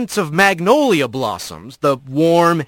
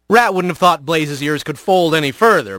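plain reading voice

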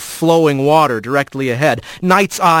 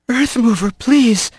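out of breath plea; panting; 6 semitones higher than usual. Acted. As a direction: gasp the words out. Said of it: "he panted out".

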